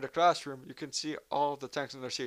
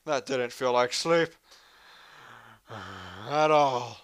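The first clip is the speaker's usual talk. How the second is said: loud, startled wake-up; jolted out of sleep; louder shocked what the hell; startled awake; 10 dB louder, much slower than usual. Acted. Waking up jolted.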